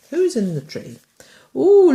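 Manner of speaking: ordinary delivery, nothing marked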